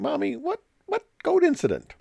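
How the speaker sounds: put-on high, piping child asking mommy; young daughter asking her mother, in a high child's voice; little daughter's voice. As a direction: squeak it out like a puzzled little girl.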